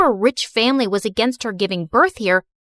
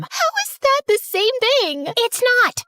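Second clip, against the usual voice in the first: put-on indignant girl; 11 semitones higher than usual. Same girl objects.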